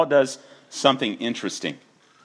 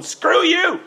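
very high, loud mock shout of a rebellious kid; defiant kid yell; 6 dB louder than usual. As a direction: hurl it like a kid at dad. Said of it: "keeps going".

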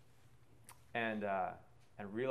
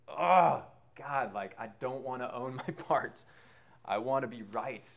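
exasperated groan; 4 semitones higher, 8 dB louder, slower than usual. Retold or imitated. Retold inner cry of pride.

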